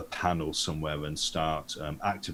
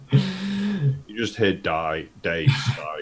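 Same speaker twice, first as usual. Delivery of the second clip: usual speaking voice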